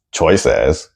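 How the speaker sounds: "Choices" drawn out and over-stressed as a parody; mock student emphasis. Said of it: "here it is".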